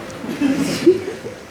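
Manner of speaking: high, breathy laugh of ridicule; mocking laugh. Man ridiculing a brother who never had a job, mocking laugh. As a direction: burst into a scornful high laugh.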